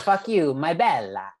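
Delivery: mock Italian twang; Italian accent. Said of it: "throw in some, like, Italian twang with it".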